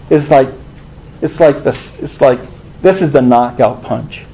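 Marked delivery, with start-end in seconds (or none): none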